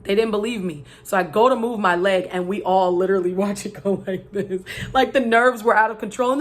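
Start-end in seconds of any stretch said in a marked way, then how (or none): none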